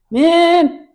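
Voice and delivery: loud imitation of an indignant rant; blowing up. Prank-called guy blows up at the callers, loud and exasperated. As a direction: scold the prank callers, pitch jumping up. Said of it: "blows the fuck up".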